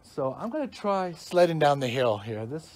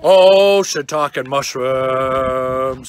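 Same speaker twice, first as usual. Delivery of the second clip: exaggerated slow exclamation; 4 semitones lower, 9 dB louder, much slower than usual. Mock curse after a mishap.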